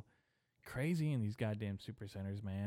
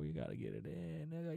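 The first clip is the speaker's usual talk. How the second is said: softer mock advice in an altered voice; 7 semitones higher, 4 dB quieter than usual. Hyped advice on sex.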